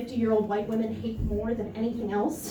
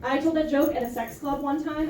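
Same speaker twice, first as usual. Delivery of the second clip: ordinary talk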